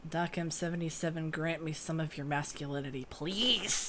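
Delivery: soft, fast mock prayer; mock pleading. Real, not acted. Gm mock-praying to Doc for masculinity. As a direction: beg in a hushed hurry. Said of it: "I beg of thee".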